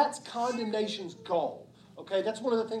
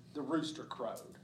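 ordinary talk

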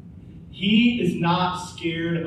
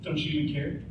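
softer, sour sarcastic jab; 3 semitones lower, 7 dB quieter than usual. Retold or imitated. Disciples needling the sleeping Jesus, quiet sarcasm. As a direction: mutter it with bitter sarcasm. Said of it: "into sarcasm".

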